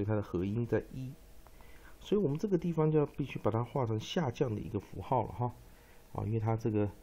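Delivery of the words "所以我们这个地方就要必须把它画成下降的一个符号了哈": usual speaking voice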